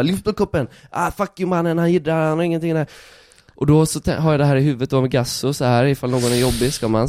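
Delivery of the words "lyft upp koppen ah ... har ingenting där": high mock heckler taunt; mimicking the heckler. Street magician mimicking the heckler in front of the crowd, high mock taunt. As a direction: parrot the thug back at him. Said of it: "Så jag bara".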